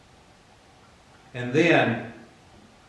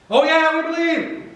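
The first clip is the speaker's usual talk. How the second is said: very high, gushing chirp; mock eagerness; 14 semitones higher, 3 dB louder than usual. Believers quick to profess faith, mock eagerness. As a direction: overdo the easy agreement, pitched way up.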